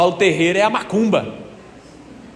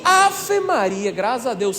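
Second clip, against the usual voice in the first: relieved exclamation; 3 semitones higher than usual. Acted. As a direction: exclaim in relief like a grateful mother. Relief the child was saved.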